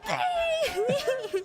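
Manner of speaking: high squealing laugh on please; real laughing protest; laughing cry. Real, not acted.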